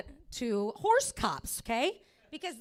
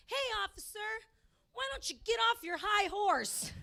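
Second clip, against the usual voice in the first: imagined comeback shout; 9 semitones higher than usual. Pretend. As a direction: fire the comeback at the cop. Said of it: "I would have been like".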